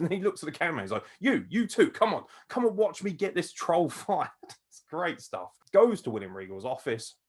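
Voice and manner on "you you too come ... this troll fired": loud, raised-pitch bossy order; pissed off. Retold or imitated. Angry wrestler ordering the camera crew along, pissed off. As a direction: imitate an indignant man rounding up witnesses. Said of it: "how fucking pissed off he was".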